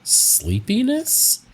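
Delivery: high, slow, puzzled sleepiness; drawn-out doubtful question. Real, not acted. Doubtful repeat of clue.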